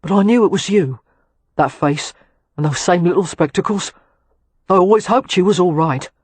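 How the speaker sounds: cockney lad glad to see her; South London accent. A narrator's reading. William speaking in his accent.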